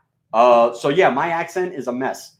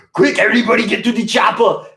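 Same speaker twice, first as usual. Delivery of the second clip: high, loud action-hero imitation; a kid's urgent action-star impression, laughing after; Arnold Schwarzenegger; 8 semitones higher, 4 dB louder than usual. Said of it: "a really good Arnold Schwarzenegger".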